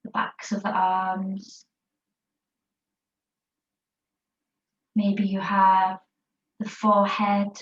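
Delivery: calm, unhurried yoga teacher voice guiding a rest pose; guided meditation. Acted. Yoga teacher guiding the class in child's pose, guided meditation voice. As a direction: ease the class into stillness, word by word.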